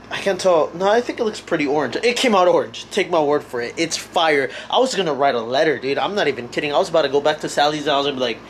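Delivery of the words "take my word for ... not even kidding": ordinary talk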